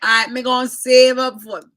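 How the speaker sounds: high, loud mock scolding; scolding advice. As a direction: lecture her loudly about her teeth. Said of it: "He said".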